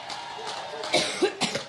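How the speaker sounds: harsh repeated coughs; coughing fit. Real, not acted. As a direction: cough hard, several times. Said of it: "once I get over my allergic reaction".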